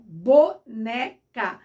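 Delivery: "boneca" slowly split BO-NÉ-CA, stress on NÉ; slow, separated "boneca" with the middle syllable strongest. Shown as a demonstration. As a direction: break "boneca" into three slow syllables, leaning on the E. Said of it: "a tonicidade tá no E".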